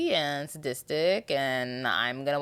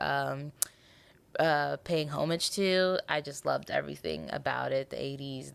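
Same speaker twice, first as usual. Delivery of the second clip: ordinary talk, nothing marked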